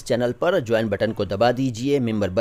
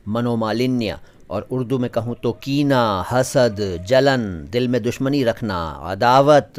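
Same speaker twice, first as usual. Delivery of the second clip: ordinary talk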